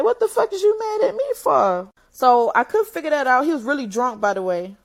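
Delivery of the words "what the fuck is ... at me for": retold angry retort.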